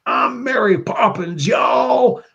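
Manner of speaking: high, slow Southern drawl on I'm Mary Poppins y'all; Southern accent. Retold or imitated. Quoting Yondu's movie line in a high Southern drawl. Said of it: "he had the Southern accent".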